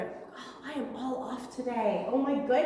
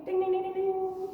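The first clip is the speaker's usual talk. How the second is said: bell imitation; 6 semitones higher than usual. Quiz answer celebrated as bell.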